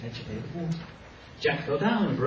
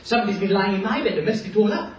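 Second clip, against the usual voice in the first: fast, lightly raised cub voice; baby bear; 5 semitones higher, 4 dB louder, faster than usual. Acted. Baby bear listing the mess, quick and lightly childish. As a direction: rattle off the complaints like a small bear. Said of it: "little baby bears say".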